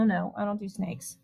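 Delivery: normal talking voice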